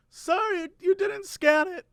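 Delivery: put-on high, soft, slow lady with a slight German accent. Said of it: "sort of has a German accent".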